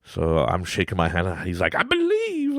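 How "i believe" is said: imitating a son's high, drawn-out declaration; high-pitched mock kid fervor; excited child. Son tells dad he believes in the trick, high excited imitation.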